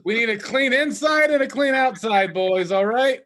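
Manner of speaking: loud, high rallying shout; rally the boys like a mock drill boss; gang leader.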